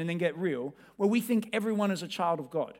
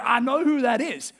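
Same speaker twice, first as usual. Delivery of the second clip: mock eager fan; 5 semitones higher, 6 dB louder than usual. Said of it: "You're like".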